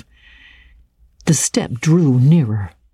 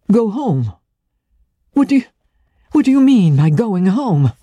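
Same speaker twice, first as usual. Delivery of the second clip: high, faltering, incredulous questioning; startled high pitch, breaking off and restarting; dismayed disbelief; 7 semitones higher than usual. A narrator's reading. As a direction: stammer it in raised disbelief. He reacts, dismayed, to her phrase.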